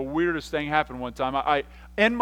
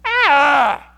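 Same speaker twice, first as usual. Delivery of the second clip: loud re-enacted yell, less shrieky; manly yell; 9 semitones higher, 12 dB louder than usual. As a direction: cry out in fright with a manly voice. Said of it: "I had to get my manly voice in there".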